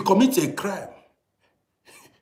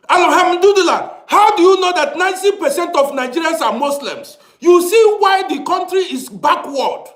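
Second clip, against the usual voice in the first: exasperated outburst; 10 semitones higher, 5 dB louder than usual. Real, not acted. Angry rant about a comment.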